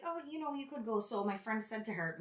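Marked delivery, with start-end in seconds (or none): 0.0-1.0 higher, softer voice for quoting a coworker; imitated coworker. Retelling a relief nurse's offer, higher imitated voice. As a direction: lightly put on her higher, softer voice.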